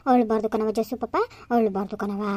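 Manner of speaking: low, slow, tender comfort; gentle hushing. Acted. Aunt hushes tearful little nephew, soothing. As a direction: soothe the child to stop crying.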